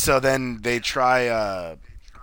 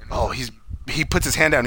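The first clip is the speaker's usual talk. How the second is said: usual speaking voice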